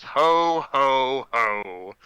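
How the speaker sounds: booming jump-scare Santa; yelling Santa. Acted. Game master springs an inflatable Santa on a player, yelling. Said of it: "pops out in your face and yells".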